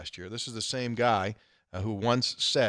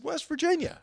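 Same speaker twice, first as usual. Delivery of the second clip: high, astonished question; a stranger's startled double take; surprised echo. Retold or imitated. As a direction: repeat it in amazement. Man's surprised echo, retold.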